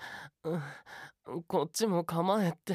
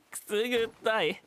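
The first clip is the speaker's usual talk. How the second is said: laughing while tickled; being tickled; 5 semitones higher, 3 dB louder than usual. Real, not acted. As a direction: squirm and giggle through it.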